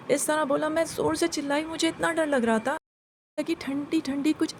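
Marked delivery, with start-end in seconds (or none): none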